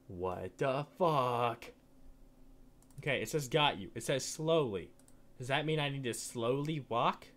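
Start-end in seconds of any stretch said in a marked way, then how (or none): 0.1-1.6 slow, drawn-out and lower; low, slow bafflement; baffled disbelief. Real, not acted. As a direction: stretch each word in disbelief. Baffled by the game.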